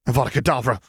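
high-pitched spat-out curse; hissed. A narrator's reading. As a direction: fling the killing curse with venom. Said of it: "Pansy hissed".

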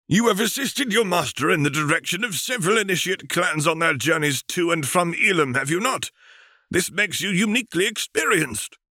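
put-on high, airy voice of an old alien master; alien Jedi master. Thespiasian master briefing Obi-Wan, in a high, stately master voice. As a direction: speak up high like a serene ancient teacher.

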